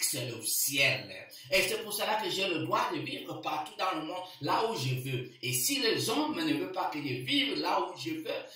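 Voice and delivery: usual speaking voice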